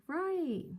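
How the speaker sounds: high, drawn mimicked singsong; sing-songy. Older man rewarding girls' guess, mock sing-songy. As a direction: imitate him playfully confirming a guess. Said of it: "sing-songy kind of a attitude".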